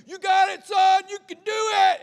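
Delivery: loud, high, drawn-out cheering shout; dad yelling encouragement. Retold or imitated. Dad to his son running onto the field, yelling. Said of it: "he'd be yelling at me".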